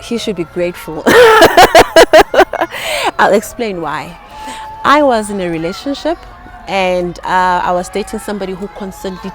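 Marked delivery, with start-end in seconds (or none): 1.1-3.1 high, loud peal of laughter. Real, not acted. Guest amused that men should be grateful, loud laugh. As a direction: laugh out loud at your own line.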